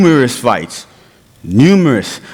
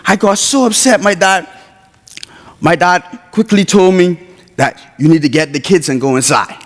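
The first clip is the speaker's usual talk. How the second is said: ordinary talk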